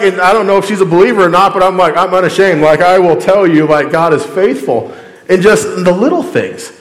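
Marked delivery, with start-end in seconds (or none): none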